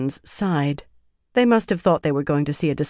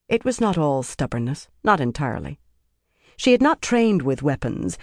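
plain reading voice

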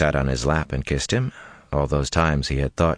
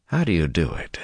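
sighed. A narrator's reading. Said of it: "he sighed".

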